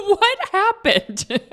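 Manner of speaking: high, slow teasing husband voice; husband teasing again, high and slow; imitating husband. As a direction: drag it out, mock puzzled. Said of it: "he was the one who mentioned".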